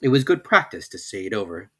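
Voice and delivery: ordinary delivery, nothing marked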